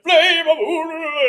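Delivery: high, slow, booming wizard incantation; Gandalf impression. Friend doing Gandalf for a podcast host, drawn-out wizard voice. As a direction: intone it like an ancient wizard. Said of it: "to do Ian McKellen".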